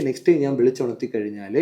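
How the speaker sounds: ordinary talk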